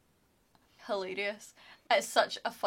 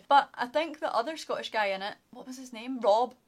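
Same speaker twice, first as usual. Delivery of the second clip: normal talking voice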